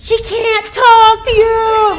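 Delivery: high, loud, slowed explanation; slow loud call. Retold or imitated. Stranger explaining to the massage lady, slow and loud. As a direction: spell it out loudly so she gets it. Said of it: "He's like".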